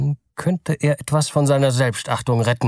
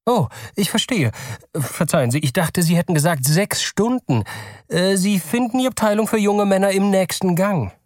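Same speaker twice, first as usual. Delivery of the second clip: a courteous salesman, pitched up; polite clerk; 9 semitones higher than usual. Acted. Store clerk asks the nervous father, polite and high.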